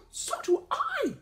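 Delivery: very high, slow squeaky exclamation; Rabbit exclaiming. A narrator's reading. Rabbit answers Noko's plea, exclaiming high. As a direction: gasp then cry it out. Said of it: "Rabbit exclaimed".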